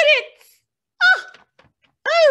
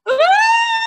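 swooning cry; 12 semitones higher than usual. Acted. A faint-like cry.

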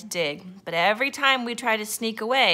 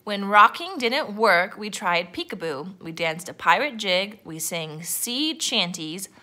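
plain reading voice

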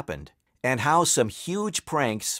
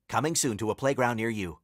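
quick, softer tagline; fast aside; 3 semitones lower, 5 dB quieter, faster than usual. Acted.

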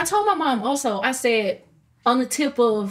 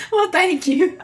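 thanks spoken through real laughter, higher; laughing as she accepts the compliment; bashful laughter while thanking; 8 semitones higher, 4 dB louder than usual. Real, not acted. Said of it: "I'm laughing because I appreciate that".

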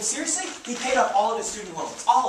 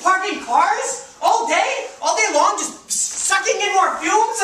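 indignant; 10 semitones higher, 10 dB louder, slower than usual. Acted. Arguing against parking lot.

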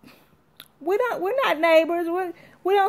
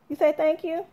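ordinary talk

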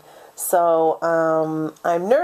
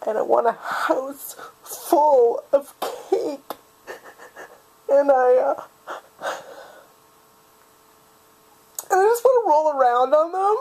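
crying harder, pitch climbing as it goes; overwhelmed, high and weepy; tearful; 4 semitones higher than usual. Acted. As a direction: lose composure bit by bit until words fail. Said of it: "I promised myself I wouldn't cry".

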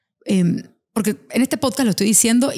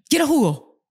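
put-on a touch higher child voice; little girl; 5 semitones higher than usual. Daughter asking for juice to avoid another standoff, lightly higher. Child asks quickly next day.

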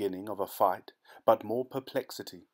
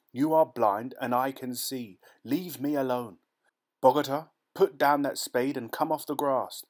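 higher, louder commanding tone; resolute shout; 4 semitones higher, 5 dB louder than usual. Acted. Nunez commands the blind villagers, trying to sound resolute. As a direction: try to sound great and resolute. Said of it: "meant to be great and resolute".

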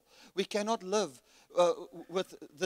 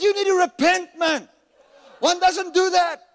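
loud, high scolding; angry shout; 11 semitones higher, 11 dB louder than usual. Retold or imitated. Shouting at the church member to repent. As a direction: bark the rebuke. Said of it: "I said".

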